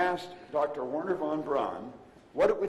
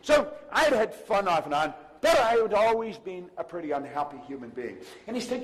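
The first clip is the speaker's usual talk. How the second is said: usual speaking voice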